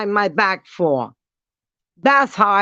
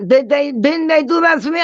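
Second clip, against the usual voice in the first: high, put-on rhetorical question; mock questioning; 6 semitones higher than usual. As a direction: ask it high, as if unsure. Mocking question answered by herself.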